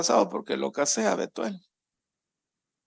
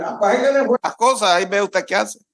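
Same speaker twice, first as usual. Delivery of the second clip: offended employee; 6 semitones higher, 4 dB louder, much faster than usual. Retold or imitated. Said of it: "le va a decir".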